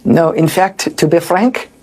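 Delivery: a failed stab at a French accent, barely shifted from his own voice; a hint of a mock French accent; fake French accent. Shown as a demonstration. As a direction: try the French accent and fall short. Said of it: "I can't even do a fake French accent".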